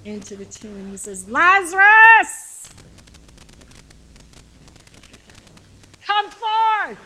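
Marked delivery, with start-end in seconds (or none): 1.3-7.0 huge, high, drawn-out shout; commanding shout. Acted. Jesus shouting into the tomb for Lazarus to come out, a commanding shout. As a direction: call the dead man out of the tomb at full voice. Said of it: "he begins to shout into the tomb".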